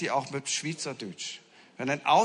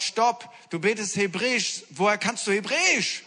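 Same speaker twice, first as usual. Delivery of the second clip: stunned; 6 semitones higher, 4 dB louder than usual. Retold or imitated.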